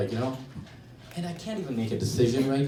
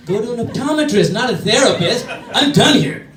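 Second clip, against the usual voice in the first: loud, high, laughing dismissal; mock outraged shout; 11 semitones higher, 13 dB louder than usual. Therapist shouts at his patient, high and laughing. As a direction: fling it at him and storm out.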